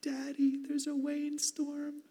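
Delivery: high, slow little-girl voice imitating his daughter; squeaky toddler calling Daddy about the storm; little daughter's high voice reporting a thunderstorm. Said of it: "my little daughter Emily ... she goes".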